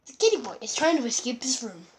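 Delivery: solemn wildlife narrator voice; nature documentary. Acted. Kid narrates cats as documentary.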